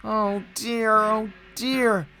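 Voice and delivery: high-pitched, woeful lament; sad. A narrator's reading. Engine shut away in the shed, sadly lamenting. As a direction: wail it gently, pitched well up, despairing. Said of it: "he thought sadly".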